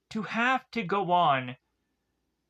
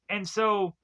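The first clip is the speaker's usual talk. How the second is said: ordinary delivery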